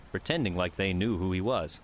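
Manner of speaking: plain reading voice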